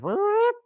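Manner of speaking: high, loud imitated bullhorn whoop; squeaky mock megaphone whoop. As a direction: mimic a bullhorn blast. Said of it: "a bullhorn goes".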